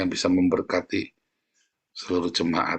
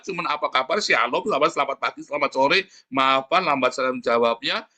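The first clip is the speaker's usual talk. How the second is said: raised-pitch, exasperated imitation of small talk; mocking pleasantries; 6 semitones higher than usual. Preacher mimicking callers' endless greetings, mildly exasperated mockery. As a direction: reel off the greetings in a higher voice, mockingly.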